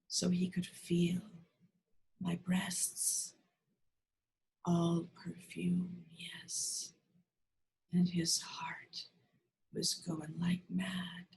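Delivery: soft half-whisper with long pauses; tender near-whispered surrender; hushed, intimate. A narrator's reading. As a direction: let each phrase fall away, slow and breathy. Intimate climax of read monologue.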